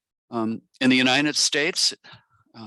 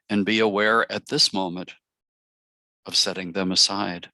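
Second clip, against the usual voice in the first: measured meditation voice, long pauses between phrases; guided meditation; 2 semitones lower than usual. Acted. Facilitator inviting the circle to relax and open, guided meditation. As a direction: guide them gently into stillness, phrase by phrase.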